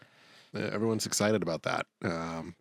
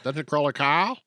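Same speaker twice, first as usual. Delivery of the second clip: "Dungeon Crawler Carl" in a Boston accent, rising at the end; 4 semitones higher, 4 dB louder than usual. Shown as a demonstration. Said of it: "my Boston accent will be out".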